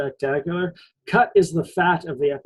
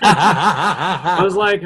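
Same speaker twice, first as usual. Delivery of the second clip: laughing; 3 semitones higher, 8 dB louder, much slower than usual. Real, not acted. Laughs mid-sentence at own joke.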